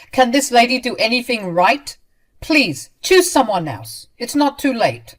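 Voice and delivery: loud, high contemptuous scolding. Acted. Mother scolding her son, loud and scornful. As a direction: shame him with disdain.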